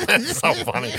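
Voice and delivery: laughing out the words, pitch way up; real giggle breaking through the line. Real, not acted. Cracking up at the recap, laughing through it.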